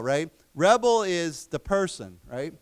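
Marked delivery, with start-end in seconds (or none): 0.5-1.0 "rebel" as a noun, REB-el; stress on first syllable. Shown as a demonstration. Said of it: "the accent is different".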